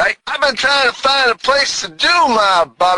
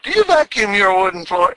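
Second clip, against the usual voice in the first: ordinary delivery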